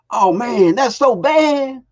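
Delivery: high, drawn-out "so bad" like a local; mock local slang. Guest mimicking how locals say bad meaning good. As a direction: stretch out "that's so bad" up high, imitating.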